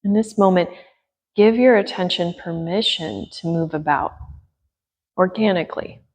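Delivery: gentle yoga teacher guiding breath and attention; guided meditation. Acted. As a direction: ease them into stillness with long pauses.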